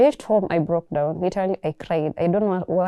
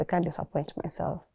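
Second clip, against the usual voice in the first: much softer, almost under her breath; quiet repeat; 2 semitones lower, 9 dB quieter than usual. Real, not acted. Repeating the vow to herself alone, much softer. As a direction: drop it down to a private murmur.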